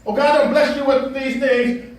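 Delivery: ordinary talk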